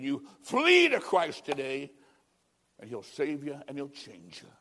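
normal talking voice

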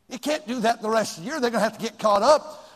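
ordinary talk, nothing marked

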